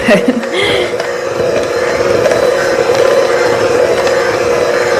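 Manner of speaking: breathless wheezy laugh; long airless wheeze of laughter; wheezing silent laugh. Real, not acted.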